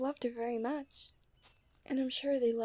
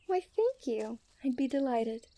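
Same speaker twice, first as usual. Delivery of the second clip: delighted thanks; 5 dB louder than usual. Acted. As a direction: accept his arm like a coquettish princess. Princess accepts offered arm.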